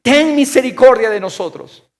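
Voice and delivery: loud, high plea for mercy; crying out. Acted. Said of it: "gritando y diciendo".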